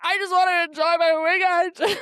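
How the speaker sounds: loud, high mock-crying whine; hammed-up sobbing complaint; fuming wail. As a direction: wail it like a tearful tantrum. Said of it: "I was so fuming".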